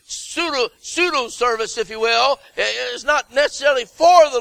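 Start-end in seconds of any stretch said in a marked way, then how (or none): none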